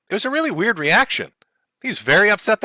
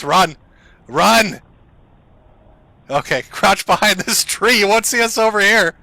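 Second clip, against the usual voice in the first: louder, mock-urgent police orders; mock alarmed officer; 3 semitones higher, 5 dB louder than usual. Pretend officer to his squad behind a tree, mock urgent. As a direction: play a cop urging the squad into cover. Acts out officers retreating from house.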